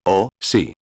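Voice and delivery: a touch of overjoyed relief, lightly cried; queen answering the king, mildly overjoyed; joyful cry. A narrator's reading. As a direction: let the relief spill out, mildly. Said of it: "gritó la reina, embriagada de alegría".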